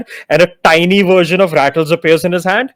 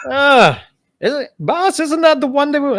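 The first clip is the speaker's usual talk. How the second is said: high questioning skeleton voice; lich voice; 9 semitones higher than usual. Acted. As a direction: ask the boss, curious. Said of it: "He just goes".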